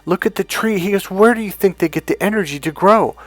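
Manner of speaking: a hint of a wealthy friend lecturing; preachy friend. Wealthy friend preaching about sun.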